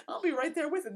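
mock eager. Imagines joining French rioters.

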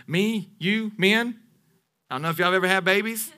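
usual speaking voice

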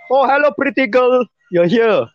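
high-pitched auntie voice; greeting auntie. Retold or imitated. Drink store auntie greeting the pretty girl, gushing. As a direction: imitate a gushing shop auntie.

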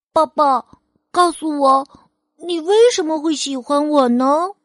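put-on high eager little boy asking; child voice. Child Phil asks father.

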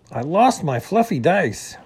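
mildly distraught character voice; upset complaint. A narrator's reading. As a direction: wail the loss insistently. Upset skunk repeats his complaint.

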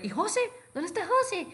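a keen friend's pitch leaping up; excited asking. Retold or imitated. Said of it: "salí del cuarto bien emocionado".

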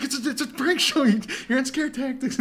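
high, loud panicked retelling; hammed-up panic, high and loud; mock panic. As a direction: imitate someone frantically explaining, pitch way up. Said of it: "she, like, panics".

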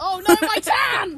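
mock dismay. Mock dismay at sun cream.

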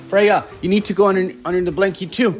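playful urgent. Pretend. Urging child to hide in game.

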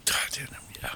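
breathy awed whisper. Real, not acted. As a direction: whisper it, stunned. Reacting to a decade in prison.

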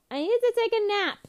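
hammed-up cry for a nap; mock meltdown. Festival-goer hitting a wall late at night, mock meltdown. Acting out her exhausted self.

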